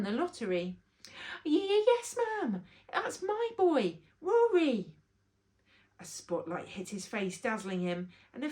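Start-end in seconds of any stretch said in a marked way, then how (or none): 1.4-4.9 thrilled reply. A narrator's reading. Bobby jumps up thrilled.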